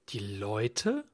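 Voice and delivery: low start, rising astonished question. A narrator's reading. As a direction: echo it in disbelief, sweeping up at the end. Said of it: "fragte Michael erstaunt". (erstaunte Nachfrage)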